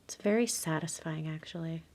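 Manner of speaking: whispered afterthought; whispered aside. Real, not acted. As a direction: trail off into a whisper. Quiet aside while crafting.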